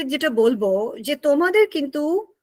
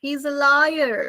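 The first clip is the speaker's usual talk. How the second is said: American liar with r pronounced; American pronunciation of liar; American accent; much slower than usual. Shown as a demonstration. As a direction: hold the r at the end of liar, American style. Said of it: "American people say liar".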